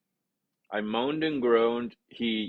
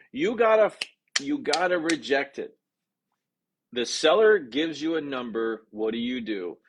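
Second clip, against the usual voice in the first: ordinary talk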